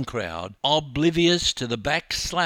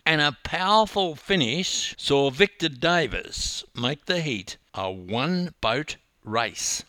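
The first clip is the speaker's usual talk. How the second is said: plain reading voice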